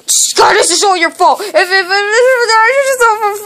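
sobbing shout that breaks into stammered ifs; crying wail. Acted. Kid crying to Scott over lost money, sobbing shout. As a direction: wail it out, choking on each if. Discovers money gone, wails.

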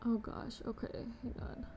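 whispered flustered aside; hushed to herself. Real, not acted. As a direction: whisper it while sorting things out.

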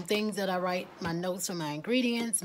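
ordinary talk, nothing marked

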